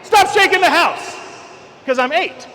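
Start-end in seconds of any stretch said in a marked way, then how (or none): 0.0-1.1 high, loud retold yell; childhood yell. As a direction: shout it like a startled eight-year-old at his dad. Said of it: "and I yell".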